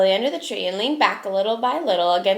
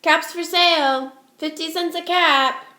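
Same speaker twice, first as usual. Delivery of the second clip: higher, louder street call, slow on caps for sale; 6 semitones higher, 5 dB louder, slower than usual. Acted. Peddler calls out his wares walking back to town. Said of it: "he walked back to town calling".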